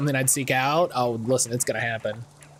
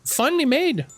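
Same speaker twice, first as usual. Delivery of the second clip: put-on Scottish accent; 7 semitones higher than usual. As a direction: lean into a lilting Scottish accent. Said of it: "give her a Scottish accent".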